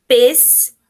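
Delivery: "Pêssego" broken into pês, se, go with long pauses; syllable by syllable. Shown as a demonstration. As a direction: split "Pêssego" into three isolated syllables. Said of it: "a gente vai separar a sílaba".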